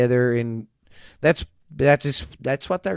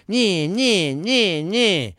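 higher, sing-song playground taunt; hammed-up childish jeering; mocking taunt; 5 semitones higher, much slower than usual. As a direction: sneer it like squabbling kids. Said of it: "it's just constantly".

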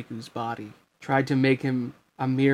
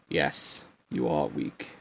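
much lower, slow menacing villain; deep villain voice; 10 semitones lower, slower than usual. A narrator's reading. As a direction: drag it out low and cruel. Ansem mocking Riku.

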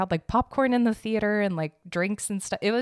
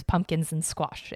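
usual speaking voice

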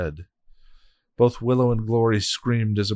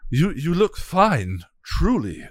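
high, slow grovelling voice; fawning minion; 10 semitones higher, 4 dB louder, slower than usual. Acted. Minion flattering the shaken hell god, grovelling. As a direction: soothe your mistress nervously.

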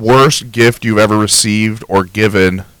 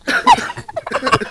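squeaky cartoon cough; 4 dB quieter than usual. Cough in put-on voice.

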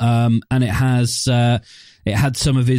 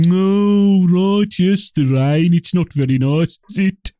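mock Brummie small talk, pitched higher; Brummie accent; 4 semitones higher, slower than usual. Zombie crowd grumbling about rain, mock Brummie accent. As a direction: drone it like a Brummie zombie on a rainy day. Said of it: "it would have just been".

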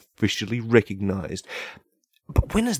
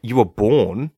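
worked-up rant; 5 semitones higher, 5 dB louder than usual. Real, not acted. Heated rant.

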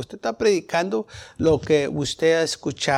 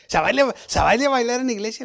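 incredulous friend; 6 semitones higher, faster than usual. Retold or imitated. Amigo incrédulo ante el baile.

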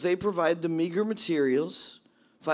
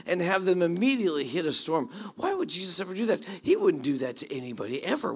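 ordinary talk, nothing marked

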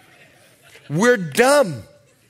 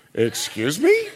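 mock offended; 4 semitones lower than usual.

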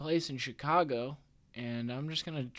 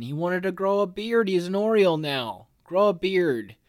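higher, louder mock outrage; excited; 6 semitones higher, 5 dB louder than usual. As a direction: play up the beard news. Mocking Hicks' beard.

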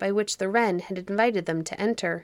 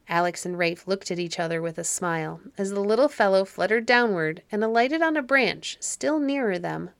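plain reading voice

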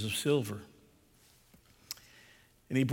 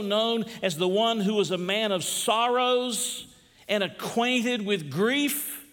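raised preaching voice; rising sermon fervor; 5 semitones higher than usual. Acted. Pastor urging hurting listeners, mild preaching fervor. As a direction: press the truth home, building.

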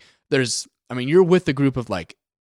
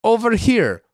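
lightly hammed Russian accent, higher and slow; mock Russian accent; 10 semitones higher, 4 dB louder than usual. Game character calling to the hero, in a mock Russian accent. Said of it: "when they, like, do the accent".

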